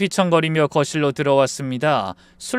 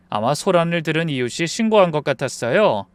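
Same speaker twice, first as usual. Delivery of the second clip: usual speaking voice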